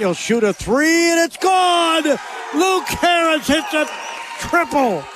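thrilled commentator at full cry; excited play-by-play. Real, not acted. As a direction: erupt as the shot drops. Calls a made three-pointer live.

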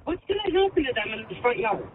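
distant recording through speakers; air check playback. Real, not acted. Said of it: "I'm air checking [name]".

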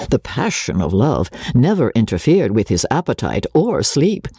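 usual speaking voice

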